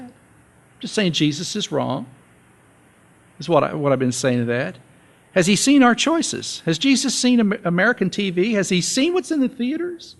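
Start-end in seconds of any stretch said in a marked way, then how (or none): 5.3-10.1 high, mocking rhetorical questions; sarcastic questions. Real, not acted. Preacher mocks excuses to church, high sarcastic questions. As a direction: ask it high with heavy irony.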